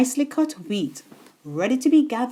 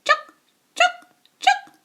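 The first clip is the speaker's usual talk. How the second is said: high, sing-song clucking call; hen calling chicks; 3 dB louder than usual. A narrator's reading. Mother hen calling her chicks, high clucking call. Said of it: "she would call".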